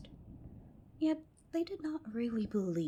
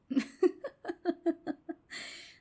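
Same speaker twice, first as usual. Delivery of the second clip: high, louder little laugh; mocking laugh; 11 semitones higher, 4 dB louder than usual. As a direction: laugh at them, high and teasing. Girl laughing at the sisters.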